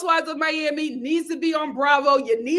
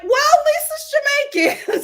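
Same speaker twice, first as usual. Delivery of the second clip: higher, louder, laughing; laughing reply; 13 semitones higher, 7 dB louder than usual. Retold or imitated. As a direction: say it cracking up. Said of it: "And I was like".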